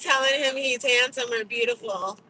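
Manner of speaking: loud, high, laughing protest; loud teasing. Real, not acted. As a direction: call out to chat playfully. Partner teasing chat loudly.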